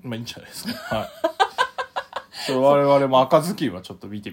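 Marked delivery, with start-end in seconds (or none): 1.1-2.4 high laugh. Real, not acted. Laugh after reading letter.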